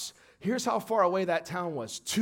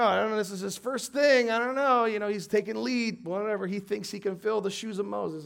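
ordinary talk, nothing marked

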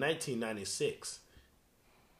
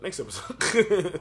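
mock dramatic; 7 semitones higher, 9 dB louder, slower than usual. Running gag, mock dramatic.

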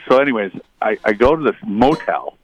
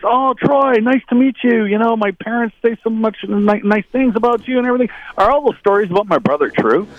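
high, bright-eyed imitation of Doug, much higher pitched; imitating Doug; 12 semitones higher than usual. Teammate Doug voiced in story.

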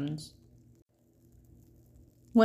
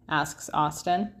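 plain reading voice

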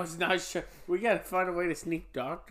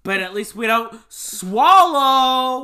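loud, slow cheeky punchline; loud joke; 6 semitones higher, 17 dB louder, slower than usual. Real, not acted. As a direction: shout the punchline.

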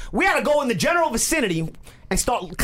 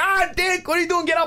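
much higher mock drunk buddy voice; drunk Peter waking him; 10 semitones higher than usual. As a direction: pitch it way up like a drunk pal pestering. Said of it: "he's like".

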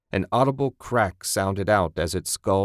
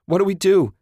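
high, loud frightened shriek; panicked shriek; 12 semitones higher, 7 dB louder, faster than usual. Acted. Woman in a car attacked by a monster, shrieking. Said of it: "Susan shrieked".

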